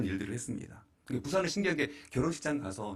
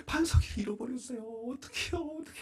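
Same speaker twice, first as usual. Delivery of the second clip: high tearful wail; father sobbing to his mother-in-law; imitating crying; 11 semitones higher than usual. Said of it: "막 울고 있으니까".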